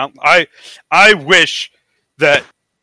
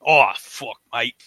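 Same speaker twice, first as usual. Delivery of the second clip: put-on lower, drawn-out British accent; mock British accent; 6 semitones lower, 5 dB quieter, slower than usual. Podcaster trying out British swearing, mock British accent. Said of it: "British people say mate also?".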